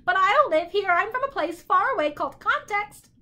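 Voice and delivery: high, loud bird voice; boastful bird. A narrator's reading. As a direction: announce it in a loud squawk. Bird character telling his origin.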